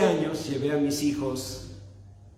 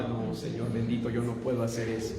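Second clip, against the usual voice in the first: frightened prayer; 7 semitones lower, 7 dB quieter, faster than usual. Retold or imitated. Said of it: "Y yo".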